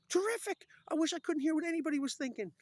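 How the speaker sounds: very high, loud comic cry; over-the-top frantic wishing; exasperated mock outburst. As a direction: shout the wish in hammed-up exasperation. Acting exasperated man making wish.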